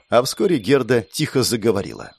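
plain reading voice

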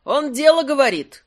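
high, loud call of agreement; audience shout. A narrator's reading. Another spectator backing him from the hall, shouting. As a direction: shout it up from the stalls. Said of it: "крикнул другой голос".

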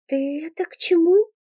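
put-on girl asking what he means; puzzled girl. A narrator's reading. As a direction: ask what he's getting at.

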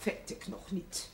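slow, under the breath mimicking; muttered echo. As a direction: grumble his words back to yourself. Housekeeper echoes him sourly.

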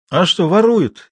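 teasingly stretched question; ironic inquiry. A narrator's reading. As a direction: ask with irony, pitch lifted. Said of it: "иронически поинтересовался Жуков".

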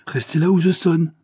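high, loud warning; crying out. A narrator's reading. Countess ordering an intruder to stay back, crying out. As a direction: call it out sharply as a threat. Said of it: "s'écria la comtesse".

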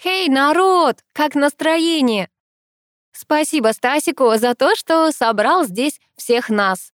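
high, slow, drawn-out crowd call; pleased party host. A narrator's reading. Gelya greets the crowd over the microphone, pleased and drawn-out. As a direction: stretch the words out to rev up the room. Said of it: "донёсся довольный голос Гели".

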